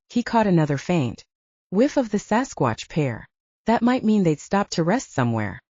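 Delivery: plain reading voice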